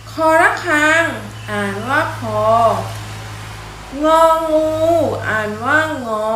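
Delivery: loud, measured classroom letter drill; alphabet recitation. Shown as a demonstration. Teacher models Thai consonant sounds for children, measured recitation. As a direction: recite each letter name, then draw out its sound. Reads the alphabet aloud for pupils.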